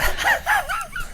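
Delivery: breathy, high and soft huffing laugh; huffy laugh. Retold or imitated. Imitating Emily's original laugh, huffy and breathy. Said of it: "like a very huffy laugh".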